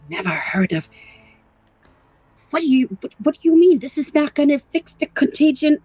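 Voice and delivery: aghast at the cure failing; alarmed. Acted. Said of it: "I'm like".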